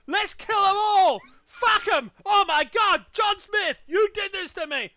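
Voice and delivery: high, loud ranting shout; hammed-up furious yelling. Mock victim shouting at every John Smith. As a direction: scream it like a madman blaming John Smith.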